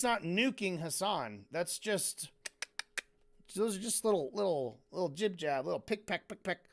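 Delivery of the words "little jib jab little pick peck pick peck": ordinary delivery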